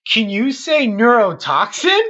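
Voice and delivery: put-on high, perky kids' show question; Dora. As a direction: imitate a cartoon host quizzing viewers. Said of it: "when Dora becomes this teenager, she's like".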